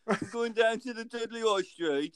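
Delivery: high, loud Dudley accent impression. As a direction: put on a Dudley voice, pitched well up. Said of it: "I can only do the Dudley one".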